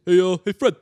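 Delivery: loosely imitated Barney Rubble; a light cartoon-sidekick voice calling Fred. A friend who sounds like Barney greets Fred, cartoonish. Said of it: "it just goes like".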